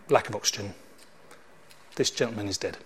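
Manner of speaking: low, soft, solemn doctor; hushed and final, lightly acted; grave pronouncement. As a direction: deliver the death news quietly.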